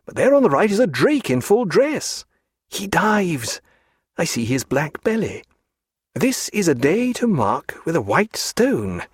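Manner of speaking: a touch of high-pitched rapture; delighted bird-watcher. A narrator's reading. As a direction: relish each sighting in a lifted, swooping voice. Narrator plays delighted bird-watcher.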